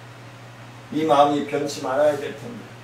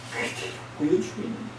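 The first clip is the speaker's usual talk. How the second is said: whisper; 9 dB quieter, faster than usual. Acted. Whispered aside after repeating phrase.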